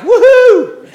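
high loud cheer; a happy antidepressant user cheering; excited whoop. Retold or imitated. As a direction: whoop like the pill just kicked in. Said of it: "They're like".

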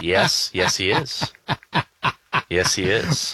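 hint of a quirky film star, only lightly voiced; Christopher Walken impression. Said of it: "Christopher Walken".

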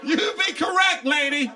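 high mock-sassy answer; high sassy retort. Real, not acted. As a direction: squeak it back at her.

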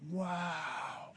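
soft breathless gasp of wonder; hushed wow. Retold or imitated. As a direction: let it out quietly, amazed. Awed at canyon again.

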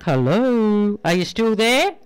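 husband calling into dark rain, loud. Acted. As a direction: call it into the rain. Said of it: "He calls out in the dark".

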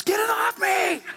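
high, slow mock shriek; panicked shriek. Wife shrieking about a spider, high mock panic. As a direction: squeal like a spider's on you. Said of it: "It's like spider dance".

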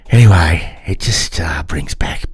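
ordinary delivery

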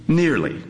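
Nearly lifted high and falling; stress on Nearly. A narrator's reading. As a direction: hang on the word Nearly. Lone repeated word, emphasised.

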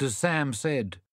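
ordinary delivery, nothing marked

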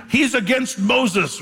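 mock accusation. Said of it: "the accusation".

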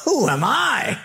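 high-pitched, incredulous protest; protesting Moses. Acted. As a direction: play an overwhelmed man objecting to his calling. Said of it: "You know what Moses' answer was?".

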